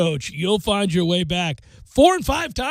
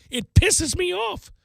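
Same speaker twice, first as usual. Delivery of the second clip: angry; 9 semitones higher, slower than usual. Real, not acted. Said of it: "I get angry watching them".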